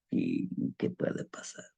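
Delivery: whispered, low and slow, barely voiced; low slow frightened whisper; fearful whisper. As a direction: whisper it to yourself, scared in the dark. Fear rising on dark road.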